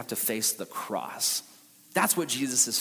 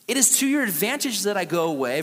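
scripture reading; 5 semitones higher, 5 dB louder than usual. Acted. Rereading the verse.

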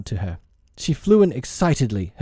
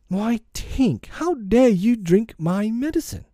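put-on high, swooping boyish indignation; indignant outcry; 11 semitones higher, slower than usual. A narrator's reading. Peter scolding the fairy for drinking his medicine, indignant. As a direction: scold in a high voice, rising to the end.

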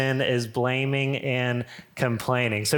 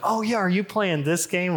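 imitating an eager doctor, higher pitch; high, enthusiastic question; super into it; 6 semitones higher than usual. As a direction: retell someone's keen interest. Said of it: "he was super into it".

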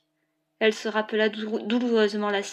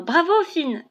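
high, louder cheer; 7 semitones higher, 5 dB louder, slower than usual. A narrator's reading. Comrades applauding Finn, cheering high and loud. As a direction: call out a rousing bravo. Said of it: "repris en chœur par ses camarades".

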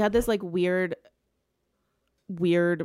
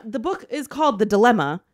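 ordinary delivery

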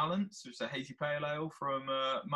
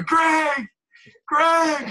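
high, loud drawn call; 13 dB louder than usual. Acted. Stranded driver calling for a helper, high loud shout. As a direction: shout the name as if searching the streets. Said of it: "Just walked around trying".

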